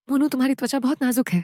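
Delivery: ordinary delivery, nothing marked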